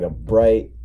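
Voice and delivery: normal talking voice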